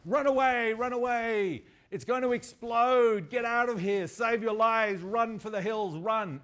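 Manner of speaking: high, loud drawn-out warning shouts; hammed-up yell of mass panic; panicked shouting. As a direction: shout the alarm through the town. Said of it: "then through the town shouting".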